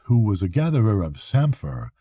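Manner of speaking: usual speaking voice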